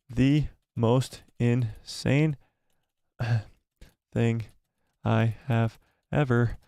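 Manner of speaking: slow, halting words with long gaps; slow typing dictation. Real, not acted. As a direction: say each word as you type it. Typing a poll option word by word.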